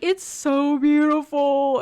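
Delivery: high, loud, hammed-up weepy wail; mock ugly crying. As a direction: wail it like a sensitive guy overcome by a sunset. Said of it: "he's crying at the sunset".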